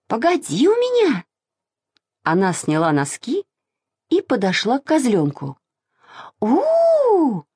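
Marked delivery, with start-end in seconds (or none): 0.1-1.2 high-pitched, plucky warning; bold threat. A narrator's reading. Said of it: "смело сказала Маша". 6.4-7.4 long, very high hoot; high, sustained howl to scare a goat; crying out "oo". A narrator's reading. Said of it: "закричала она очень громко".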